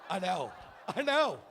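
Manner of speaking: squealing with pride; gushing dad losing it; proud squeal. Real, not acted. Proud dad reacting to son's joke.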